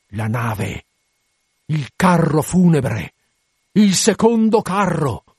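slow shout climbing high and loud; Acab shouting. A narrator's reading. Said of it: "urlò Acab dalla barca".

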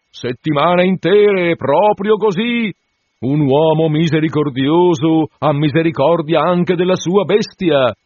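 voce più alta, lenta e solenne; il curato approva con pietà ostentata; curato compiaciuto. A narrator's reading. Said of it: "disse il curato". (curato solenne, lento e alto)

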